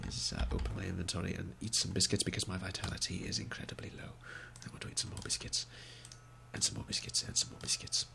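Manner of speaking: quick, hushed unvoiced whisper; fast whisper. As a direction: rattle it off under your breath. Quietly munching biscuits in inventory.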